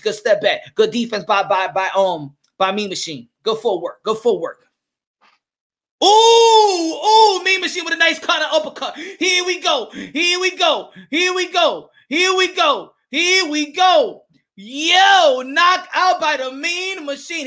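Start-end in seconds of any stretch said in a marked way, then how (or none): 6.0-17.5 high, thrilled ringside cry; excited commentator stretching out the big call; knockdown excitement. Real, not acted. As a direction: erupt at the big punch, voice soaring. Commentator reacts live to a knockdown.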